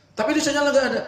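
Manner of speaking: angry customer. Acted. Angry caller complaining to the phone agent, high heated complaint. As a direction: complain hotly like an irate caller.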